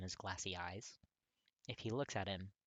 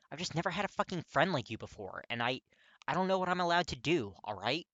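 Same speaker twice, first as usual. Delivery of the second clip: higher and louder, exasperated; frustrated young man; 7 semitones higher, 10 dB louder than usual. A narrator's reading. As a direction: push it out, lost and frustrated. Katsuki frustrated confession.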